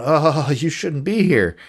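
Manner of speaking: mildly hammed-up fretting; retold freak-out. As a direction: imitate someone freaking out, stretching it out. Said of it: "she's freaking out going".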